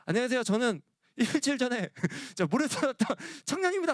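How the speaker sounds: put-on high, nervous young man at the door; nervous greeting. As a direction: greet timidly, eager to please.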